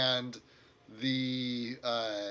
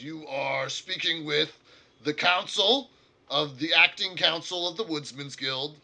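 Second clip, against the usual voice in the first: orc council leader; 4 semitones higher, 8 dB louder than usual. Acted. Orc leader to the adventurers, stiff and formal. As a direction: announce yourself as the guild's proud orc leader.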